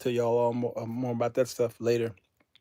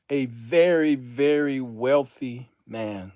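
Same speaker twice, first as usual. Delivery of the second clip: drawn-out, weighty statement at full voice; stately and deliberate; slow declaration; slower than usual. Real, not acted. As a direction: announce it slowly to everyone. First take, declared aloud.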